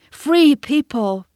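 high, loud, slow call; cried out. A narrator's reading. Mowgli calling out to the wolf pack, loud. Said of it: "he cried".

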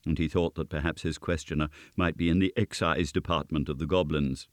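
ordinary delivery, nothing marked

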